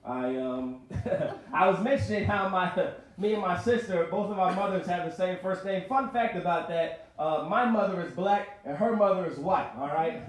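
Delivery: usual speaking voice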